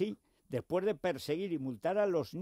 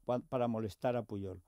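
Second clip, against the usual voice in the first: usual speaking voice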